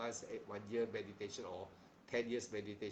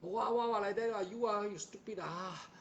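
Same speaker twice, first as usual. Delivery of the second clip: high, loud, mocking babble of careless talkers; mock the noisy gossipers, pitched way up; teacher mocking ignorant people's loud feverish talk, high and loud; feverish chatter; 9 semitones higher, 6 dB louder than usual. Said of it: "they feverish talk".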